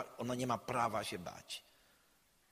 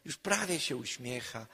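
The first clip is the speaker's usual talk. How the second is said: ordinary talk, nothing marked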